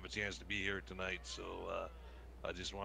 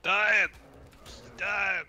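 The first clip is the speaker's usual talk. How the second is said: loud high cry, dying; yelling dying; 12 semitones higher, 13 dB louder than usual. Pretend. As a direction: wail it out as your character falls. Character dying in the game.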